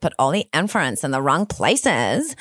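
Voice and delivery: put-on Californian Valley Girl, rising stretched endings. Shown as a demonstration. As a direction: parody a Valley Girl, dragging out the phrase endings. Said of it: "like, Valley Girl".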